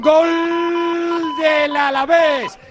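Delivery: loud, drawn-out goal announcement; radio reporter breaking in with a goal; goal call. Real, not acted. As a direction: belt out the goal, then recount it with force.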